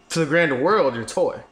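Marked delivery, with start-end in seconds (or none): none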